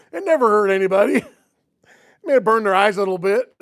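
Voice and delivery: cracking up while playing down the harm; laughing. Real, not acted. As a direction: talk through your chuckles. Laughing at his own prank story.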